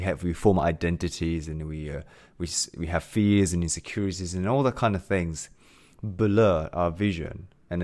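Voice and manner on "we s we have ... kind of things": ordinary delivery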